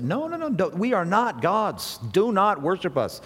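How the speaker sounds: urgent protest. Retold or imitated. Apostles begging the crowd to stop, high urgent plea. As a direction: stop them from worshipping you.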